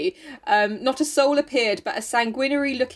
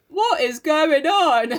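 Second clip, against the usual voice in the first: mock baffled; 4 semitones higher, much slower than usual. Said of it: "John's like".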